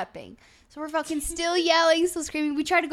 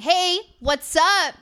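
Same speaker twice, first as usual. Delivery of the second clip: high, slow, drawn-out hey; loud greeting; 4 semitones higher, 8 dB louder, much slower than usual. Retold or imitated. Climbing on a sleeping friend's bed, loudly greeting. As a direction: boom it out to rouse someone.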